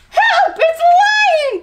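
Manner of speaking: very high, loud panicked shriek; frightened cry. A narrator's reading. Chameleon fooled by a lion disguise, shrieking in fright. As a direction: shriek for help in alarm. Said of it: "Chameleon gasped".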